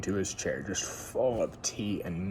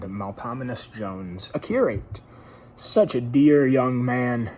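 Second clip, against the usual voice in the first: plain reading voice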